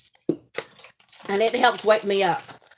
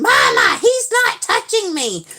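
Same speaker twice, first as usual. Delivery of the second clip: put-on much higher, louder whiny kid scream; child screaming; 14 semitones higher, 5 dB louder than usual. Daughter screaming to mom about her brother, a high kid's wail. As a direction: shriek it like a fed-up little girl. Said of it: "to hear my child scream".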